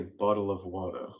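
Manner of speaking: American bottle of water, with a hard t; American accent. Shown as a demonstration. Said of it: "I say".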